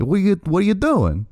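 a hammed-up Italian-American wiseguy from the Sopranos; mock Boston attempt. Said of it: "that's not a Boston accent".

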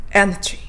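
エナジー with lips pouted; English energy, lips pushed out; アヒル口でエナジー; duck-lip pronunciation. Shown as a demonstration. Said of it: "そのまま".

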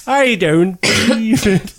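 put-on slow, high exasperated old man voice; Richard Wilson impression. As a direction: groan it out like a fed-up pensioner. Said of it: "Richard Wilson".

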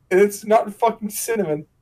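ordinary talk, nothing marked

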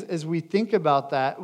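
usual speaking voice

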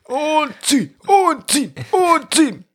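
high strained pulling; straining grunt. Pretend. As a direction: push it out with strain. Strained high crossfit cues.

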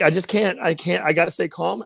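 ordinary talk

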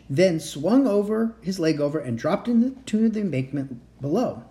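ordinary delivery, nothing marked

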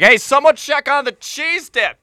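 high, slow, hammed-up dad calling back; high slow mock call to the kids in back. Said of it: "He's like".

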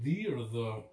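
"the" as thee, then "the" as thuh; two pronunciations of the. Shown as a demonstration. Asking how the friend says "the", both forms contrasted. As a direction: contrast "the" with long ee against "the" with a short uh. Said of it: "how I say the".

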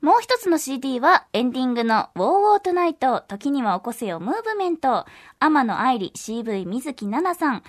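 upbeat announcer with lifted phrases; polished promotional announcement; radio promo read. Acted. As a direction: pitch the news to listeners clearly. Reads release news.

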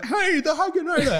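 high-pitched mock greeting; calling out. Someone greets Hagrid by his new nickname, calling out. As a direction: hail a pal by nickname.

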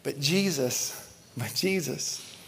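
slow, softening on Jesus; slow dramatic hush. Acted. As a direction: draw out the name, hushed. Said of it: "but watch this".

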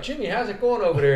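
high, teasing call to a player; Skip's teasing. Retold or imitated. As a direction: needle him with a sing-song call. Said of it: "And Skip's like".

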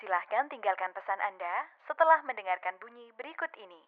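recorded operator voice on the line; automated voicemail prompt; phone menu voice. Acted.

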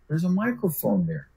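ordinary talk, nothing marked

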